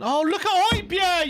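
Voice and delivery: high, drawn-out cry of disbelief; exasperated outburst. Real, not acted. Fan reacting to Højbjerg's stupid play, exasperated cry. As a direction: stretch it out, high and indignant. Outraged reaction to player's mistake.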